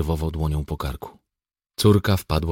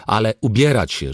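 loud, higher-pitched command; tossed-out order; 6 semitones higher, 7 dB louder than usual. A narrator's reading. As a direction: order the kids to get dressed. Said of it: "rzucił". (głośne, rzucone polecenie)

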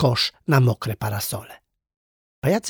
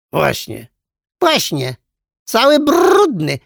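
very high, loud, slow squawk at the end; screeching parrot; 10 semitones higher, 7 dB louder than usual. A narrator's reading. Parrot repeating the princess's insult, screeching. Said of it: "zaskrzeczała papuga".

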